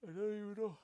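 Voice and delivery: words stretched by a real yawn. Real, not acted. Speaker admitting an unprofessional yawn mid-talk, yawning. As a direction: talk through a yawn, pitch lifted. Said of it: "I'm yawning".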